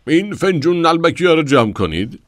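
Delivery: high, loud shout across the platform; a loud high call to a nurse. Acted. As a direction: yell to the nurse to clear the cups. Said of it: "داد زد".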